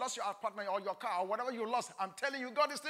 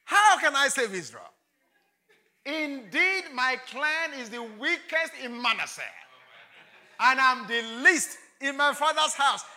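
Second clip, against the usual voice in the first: louder, pleading protest; Gideon's excuses; 4 semitones higher, 6 dB louder than usual. Acted. Preacher voices Gideon excusing himself to God, loud pleading. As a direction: protest your weakness insistently.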